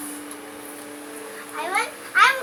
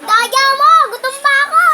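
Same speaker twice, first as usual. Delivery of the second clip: shouted complaint, cheated; loud protest; 5 semitones higher, 8 dB louder than usual. Real, not acted. Kids arguing over food. (malakas na reklamo)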